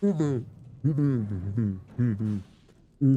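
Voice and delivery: very low humming to match a man; Dartigan. Acted. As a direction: hum your way down to his pitch. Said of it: "do your Dartigan impression".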